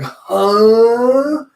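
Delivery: loud, rising drawn-out squawk; hammed-up outraged disbelief; incredulous huh.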